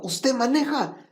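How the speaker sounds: put-on incredulous child's question; surprised child. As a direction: ask it wide-eyed like a kid. Child asks the pastor.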